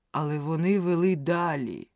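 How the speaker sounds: usual speaking voice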